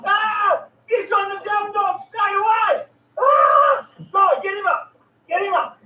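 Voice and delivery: shouting the fish up, real thrill; excited shouting. Real, not acted. Fish bites, shouting at the line.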